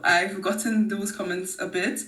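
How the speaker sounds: ordinary delivery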